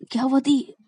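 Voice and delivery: high-pitched inquiry; curious question. Acted. Rani curiously asks what happened.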